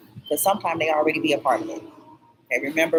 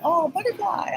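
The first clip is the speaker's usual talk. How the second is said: much higher sing-song coo; cooing at a butterfly; 13 semitones higher than usual. Retold or imitated. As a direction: coo up high like talking to a tiny creature.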